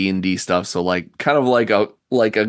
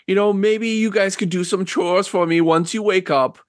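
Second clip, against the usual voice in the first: put-on high, hesitant elderly lady; old woman relenting; 13 semitones higher than usual. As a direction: concede haltingly in an old-lady voice. Game master plays old woman.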